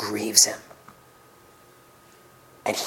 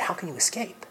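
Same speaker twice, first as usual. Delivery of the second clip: breathless whisper of a question; whispered grief; 4 semitones higher, 10 dB quieter than usual. Acted. Jesus pleads gently with the Pharisees, in a whisper. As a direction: whisper it in sorrow. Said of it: "Notice, he did not say".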